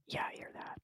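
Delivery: very hushed acted whisper. Joey answering a friend about footsteps, whispered. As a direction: whisper back, barely audible. Secret exchange in the dark.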